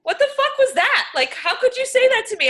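indignant, voice climbing high at the insult; outraged disbelief. Real, not acted. Reliving an insult from a stranger, high outraged disbelief.

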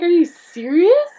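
high, loud retold excitement; reliving the thrill of hearing his praise; excited disbelief. To the designer who praised her, excited disbelief. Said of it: "I was so excited".

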